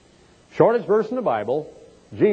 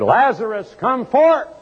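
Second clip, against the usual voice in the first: high ringing command, much higher; commanding call; 8 semitones higher than usual. Acted. Jesus calls dead Lazarus out of the tomb, commanding shout.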